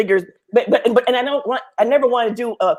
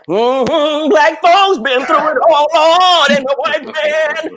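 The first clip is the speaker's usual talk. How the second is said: put-on high, loud, drawn-out old preacher voice; mock preacher voice; 6 semitones higher, 4 dB louder, much slower than usual. Mocking students who dwell on slavery, as a preacher. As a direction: ham up a churchy lament.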